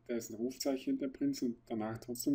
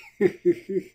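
put-on drunken giggle; 9 semitones higher, 11 dB louder than usual.

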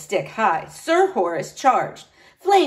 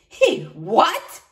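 higher, incredulous question; choked disbelief; 8 semitones higher, 4 dB louder than usual. A narrator's reading. Knight shocked by talk of hugs, incredulous. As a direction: splutter it, taken aback. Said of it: "Sir Horace choked".

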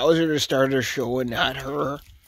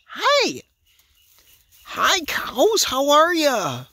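much higher, sing-song greeting, slow; pet talk; 8 semitones higher, slower than usual. Real, not acted. Greeting the curious cows, high cooing pet voice. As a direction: coo at them like pets.

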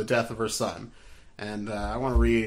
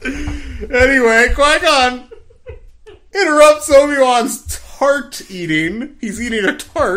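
high and loud, fighting off giggles; laughing as he resumes the recap; laughing through it; 12 semitones higher, 9 dB louder than usual. Real, not acted. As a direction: try to carry on while still laughing. Still laughing after joke.

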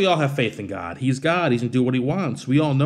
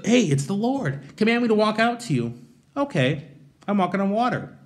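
higher, cheerful eager voice; eager Peter; 7 semitones higher than usual. Retold or imitated. Peter calling to Jesus from the boat, eager and upbeat. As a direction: sound like a delighted fisherman.

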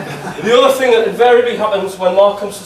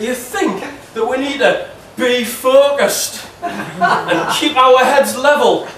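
broad Geordie, higher and slow, scolding a footballer; strong Geordie accent; 6 semitones higher, slower than usual. Acted. Fan texting striker Shola, read in a strong Geordie accent. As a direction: read the angry fan's text in a thick Geordie accent. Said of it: "I'm gonna do it in my strongest Geordie accent".

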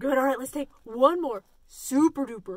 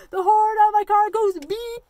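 "beep" called out higher and louder; spoken horn line; 7 semitones higher, 8 dB louder than usual. Shown as a demonstration. Teacher announcing the horn to kids, loud and high. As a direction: say it big before singing. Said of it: "the most important part is the horn".

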